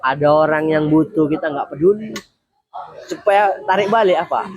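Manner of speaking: ordinary delivery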